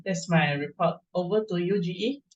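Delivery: ordinary delivery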